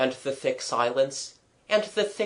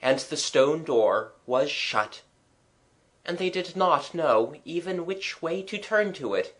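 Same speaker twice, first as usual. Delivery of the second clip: usual speaking voice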